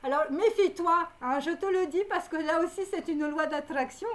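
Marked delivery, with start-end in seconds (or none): none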